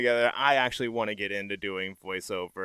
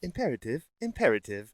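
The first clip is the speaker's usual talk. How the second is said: calm ship computer. Shown as a demonstration. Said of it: "why don't you do a computer voice".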